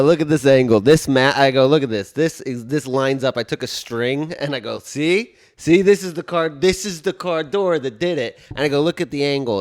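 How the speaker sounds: retold loud insistence to the officer; insistent loud quote. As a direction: hammer the point home loudly.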